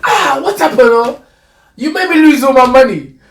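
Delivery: high, loud, outraged Nigerian man; angry Nigerian punter. Retold or imitated. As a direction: yell it like a punter who just lost his bet. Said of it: "he says".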